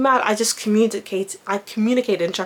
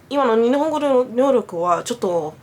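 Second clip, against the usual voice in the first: usual speaking voice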